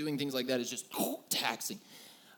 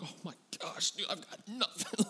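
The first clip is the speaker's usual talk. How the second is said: exhausted confession; 4 semitones higher, 6 dB quieter than usual. Retold or imitated.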